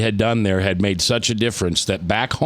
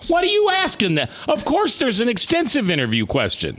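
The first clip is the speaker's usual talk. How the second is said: high-pitched mock disbelief, easing at the end; hammed-up incredulous protest; mock indignation; 11 semitones higher than usual. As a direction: feign outrage at a silly question. Mock outburst at the question.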